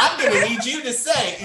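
loud, high mock director; hammed-up coaxing of a child actor, laughing; David Lynch. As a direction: call out direction from the set. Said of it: "imagine David Lynch on the set directing".

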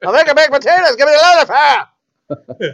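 high, loud Irish granny voice; hammed-up Irish gran demanding foil; Irish grandmother. Grandmother demanding foil for potatoes, loud Irish accent. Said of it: "That's how my grandmother sounds".

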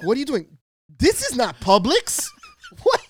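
loud, high-pitched hammed-up outrage; mock disbelief. Customer pretending this is not Publix, loud mock disbelief. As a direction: act baffled and scandalized, voice jumping up high. Acts out shocked store customer.

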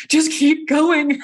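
high, very slow urging from the patient. Retold or imitated. Patient in pain urges her healer on, high and slow. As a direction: stretch it out, pushing her healer through the pain. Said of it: "and she said".

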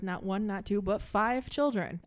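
ordinary talk, nothing marked

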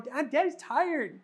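a dizzy dad begging his daughters to let him rest; worn-out dad. Retold or imitated. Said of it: "And I'm going".

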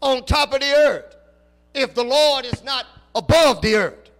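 loud, rising preacher's cadence, slow and weighty; preaching at full force. Acted. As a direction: preach it out to the congregation. Preacher building to a climax.

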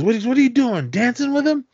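squeaky mock outrage from a tough guy; mock disbelief. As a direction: scoff at him for holding back. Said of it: "he goes".

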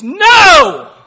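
sudden, very loud high shout of NO; scream in your face. Pretend. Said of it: "he will scream in your face".

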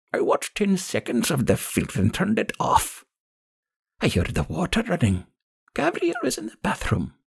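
put-on high, grieving old woman's account; high gravelly crone, bereaved and accusing. A narrator's reading. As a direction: recount a death as a bitter old woman. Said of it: "The old woman's gravelly voice".

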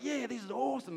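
high, hammed-up enthusiasm; mock excitement. Friends hyped about moving onto land, mock excited. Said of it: "everyone's like".

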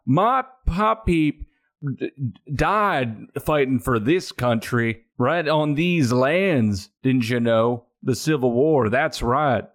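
put-on Southern accent. City boy claiming local kin, put-on Southern accent. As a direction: bluff local roots in a country accent. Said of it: "trouble being consistent with accents".

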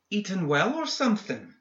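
high, sneering jab. A narrator's reading. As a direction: sneer the question at him. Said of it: "he said with a sneer".

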